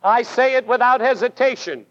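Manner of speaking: ordinary talk, nothing marked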